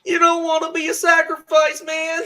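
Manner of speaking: high, loud panicky stoner voice; squeaky frightened cartoon stoner; Shaggy impression. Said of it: "I have a really good Shaggy impression".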